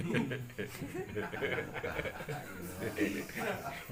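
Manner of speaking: short real laugh, rising. Real, not acted. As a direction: let a laugh burst out. Audience laughs at joke.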